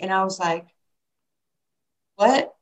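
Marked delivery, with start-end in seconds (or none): 2.2-2.5 higher, rising puzzled question; mock bafflement. Teenager baffled by her idol's lesson, mock puzzled. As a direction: react as if totally lost. Young self baffled by Ali.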